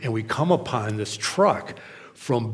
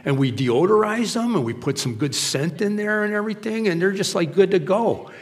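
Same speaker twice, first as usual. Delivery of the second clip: lightly voiced workman, pitched higher; imitated cleaner; 6 semitones higher than usual. Cleaner explaining his job to preacher, lightly imitated pitch. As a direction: explain the service in an upbeat lilt. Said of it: "He's going".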